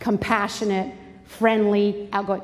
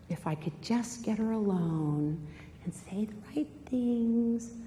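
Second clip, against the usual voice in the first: scheming thought; 10 dB quieter than usual. Acted. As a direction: murmur it as a schemer's private thought. Voices Amnon's secret scheming thought.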